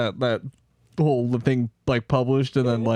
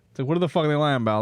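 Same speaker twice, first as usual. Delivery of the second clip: normal talking voice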